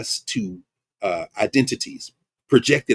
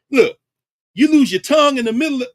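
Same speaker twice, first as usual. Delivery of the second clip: high, louder call out; raised preaching voice; loud exhortation; 12 semitones higher, 7 dB louder than usual. Real, not acted. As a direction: shout it at the crowd. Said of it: "preaching to listeners".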